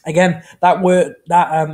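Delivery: ordinary talk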